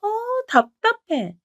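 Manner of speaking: high, fed-up exclamation; exasperated. Acted. Younger sister-in-law fed up with her elder, exasperated and high. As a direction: throw up her hands at the other woman.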